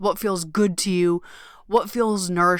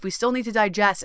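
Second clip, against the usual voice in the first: ordinary talk, nothing marked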